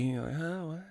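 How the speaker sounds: just woken in the night, barely audible; confused, half-asleep murmur; groggy. Pretend. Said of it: "still groggy".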